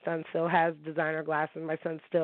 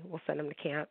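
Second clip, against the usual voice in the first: quieter repeat; 7 dB quieter than usual. Shown as a demonstration. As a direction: repeat it much softer, like a correction. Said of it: "I, I'm sorry".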